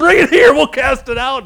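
high, loud commanding shout; loud mock-forceful command; shouting disciples. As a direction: bark it like a would-be exorcist. Disciples trying to cast out demon.

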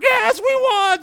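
high, loud victory shout; hammed-up ecstatic parent yell; triumphant cheer. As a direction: celebrate like you just won. Acting parents celebrating first word.

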